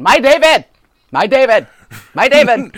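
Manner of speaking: loud, high, repeated exasperated yell; screaming at the screen. Retold or imitated. Fed-up viewer yelling at the TV screen, loud repeated cry. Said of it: "I just kept screaming at the screen".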